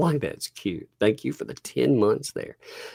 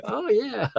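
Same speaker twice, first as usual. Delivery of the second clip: high mock voice. Mock reaction after quote.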